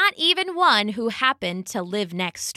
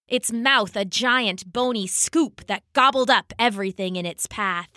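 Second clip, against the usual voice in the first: ordinary delivery, nothing marked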